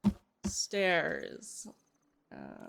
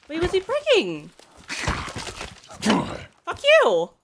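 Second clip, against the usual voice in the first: high, loud angry shouting; furious yelling; 7 semitones higher, 12 dB louder than usual. Real, not acted. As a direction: yell at the monster wrecking your stuff. Enemy breaking their base.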